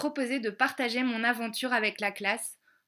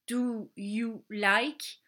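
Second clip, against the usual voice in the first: slow demo; much slower than usual. Shown as a demonstration. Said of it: "veut dire".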